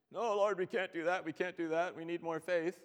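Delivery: hammed-up complaining followers; whiny protesting disciples.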